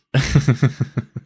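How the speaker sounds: real involuntary chuckle; reactive laugh. Real, not acted. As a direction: laugh it off, caught off guard. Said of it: "I'm laughing out of reaction".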